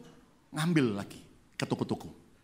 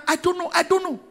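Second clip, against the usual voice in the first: halting English; 5 dB louder than usual. Retold or imitated. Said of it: "Inggrisnya juga terbata-bata".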